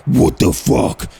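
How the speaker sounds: deep slow menacing what the fuck; angry big Brody. Retold or imitated. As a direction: play the huge angry mate, low and slow.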